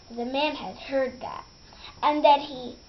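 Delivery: ordinary delivery, nothing marked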